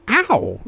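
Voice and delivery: sudden high-pitched yelp. A narrator's reading. Woman bitten on the finger by her lover, yelping. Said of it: "she yelps".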